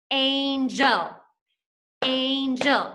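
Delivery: each syllable of Angel set apart as if clapped. Shown as a demonstration. Teacher clapping Angel out for the class in beats. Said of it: "Can you clap that out with me?".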